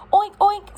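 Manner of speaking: high, loud spoken oinks; pig oink. A narrator's reading. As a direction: oink out brightly as the storybook pig. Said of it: "said Pig".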